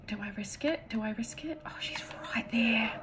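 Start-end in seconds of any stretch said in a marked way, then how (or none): 1.6-2.9 tense hushed whisper; real hiding whisper. Real, not acted. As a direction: keep it under your breath. Hiding from enemy.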